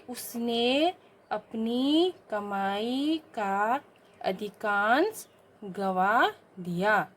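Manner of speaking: very slow reading of the wrong sentence; slow dictation. Shown as a demonstration. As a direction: read each word slowly for pupils. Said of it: "शुद्ध रूप करने के लिए". (धीमा पाठ)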